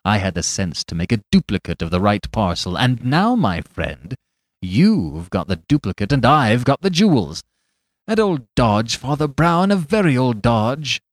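loud gloating triumph; triumphant cry. Acted. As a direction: crow over the beaten priest. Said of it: "he cried".

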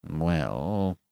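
low, soft, reluctant admission; hesitant. A narrator's reading. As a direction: admit it hesitantly, softly. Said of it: "admitted the clerk hesitantly".

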